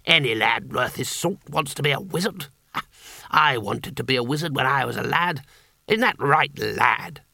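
a hint of a hearty old wizard; lightly voiced jovial wizard. Wizard Bilias humours a young boy, lightly voiced joviality.

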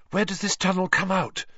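high, out of breath question; breathless. A narrator's reading. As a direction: ask it short of breath. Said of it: "Hermione asked breathlessly".

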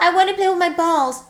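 lightly voiced high childish whine; a hint of a pouty little boy; mock man-child whine. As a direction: join in mocking the sulky boy. Joins mocking the guy.

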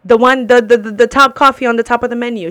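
pointing at the menu, fed up and loud; frustrated retold insistence. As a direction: insist on it at full volume. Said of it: "And I'm like".

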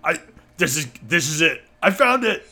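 excited high shout, building; yelling down stairs. Acted. Player yells downstairs to Charles, rising excited shout. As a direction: call out loudly to someone below. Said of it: "yell down the stairs at Charles".

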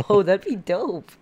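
loud, slow, delighted "dope"; excited. Real, not acted. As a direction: gush over the idea loudly.

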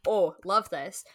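normal talking voice

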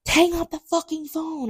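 high strained half-whispered scream; retold screaming. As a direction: mimic a furious yell, held back in volume. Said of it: "I could hear him screaming at him".

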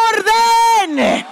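loud sharp shout of Orden; shouted Orden, clipped to one syllable; shouted command word. Shown as a demonstration. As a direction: bark the word Orden at your neighbour. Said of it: "Dile al que te queda al lado".

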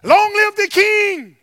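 high, loud acclamation; exultant shout. Retold or imitated. Preacher voices Palm Sunday crowd, exultant shout. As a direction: cry it out like a crowd hailing its king. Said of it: "They were shouting".

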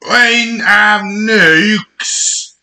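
very high, very slow posh British; mock British. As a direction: stretch it out like a silly aristocrat. Mocking the Queen's English.

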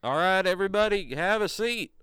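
Charles on loudspeaker. Acted. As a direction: call the room to order. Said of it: "the voice of Charles kinda come through the loudspeaker".

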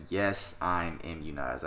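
slowed, careful imitation of a denial; slow mock quote of Rodgers. Imitating Aaron Rodgers slowly dodging the vaccine question. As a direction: say it slowly, like a dodgy official answer. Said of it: "and he said".

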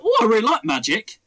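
put-on light up like a kid at a party; eager guest. Partygoer telling the magician they love magic, eager. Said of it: "and you go".

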